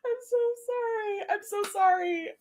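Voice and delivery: helpless giggling apology, tears of laughter; laughing apology. Real, not acted. Laughing apology after reading kiss.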